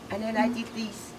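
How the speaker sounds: ordinary delivery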